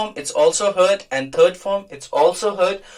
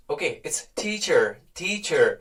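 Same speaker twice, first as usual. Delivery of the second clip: American teacher; 2 semitones lower, 3 dB quieter, much slower than usual. Shown as a demonstration. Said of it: "ट पे थोड़ा सा stress है और आर भी".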